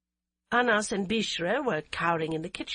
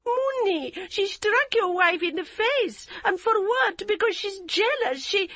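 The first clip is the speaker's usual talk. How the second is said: agitated plea; 13 semitones higher, 5 dB louder than usual. A narrator's reading. Yum pleading her case.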